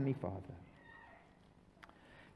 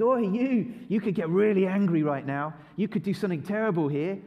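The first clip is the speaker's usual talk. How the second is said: loud goading tempter; old master shouting, goading to anger; tempting shout; 3 semitones higher, 5 dB louder than usual. Acted. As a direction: bark it like a bully egging them on. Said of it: "our old master shouts out and says".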